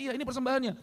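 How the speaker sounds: high-pitched acted reply; wife's assurance. Wife assures apostle about the offering, acted high-pitched assurance. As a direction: play the wife answering, voice pitched well up.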